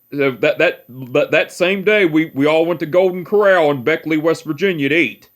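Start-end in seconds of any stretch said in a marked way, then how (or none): none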